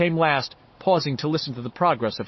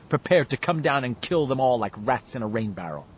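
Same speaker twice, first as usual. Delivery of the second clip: usual speaking voice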